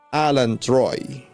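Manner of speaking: normal talking voice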